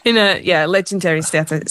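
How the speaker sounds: ordinary talk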